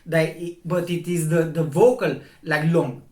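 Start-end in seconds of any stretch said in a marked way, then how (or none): none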